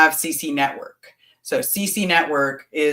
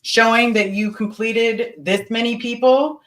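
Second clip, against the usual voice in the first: high-pitched, demanding re-enacted grilling; nasty interrogation; 6 semitones higher than usual. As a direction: grill them for missing proof, pitched up. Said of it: "Is that how you talked?".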